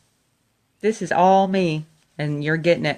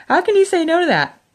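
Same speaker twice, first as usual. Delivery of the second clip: much higher and louder, near tears; overwhelmed mother's cry; emotional repeat; 12 semitones higher, 6 dB louder than usual. Real, not acted. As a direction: repeat it with a choked-up burst. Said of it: "repeat with laugh".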